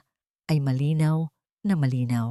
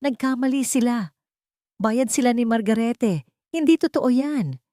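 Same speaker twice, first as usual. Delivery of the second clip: high, pleading denial rising in panic; a mother clinging to hope in a hearing of disbelief; desperate denial; 7 semitones higher than usual. A narrator's reading. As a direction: refuse to believe it, voice climbing higher. Denial after DNA result.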